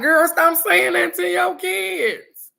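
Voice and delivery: high, loud, slow mock talk-show scolding; mock TV talk-show lady. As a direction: ham up a sassy TV host telling parents off. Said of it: "y'all be like".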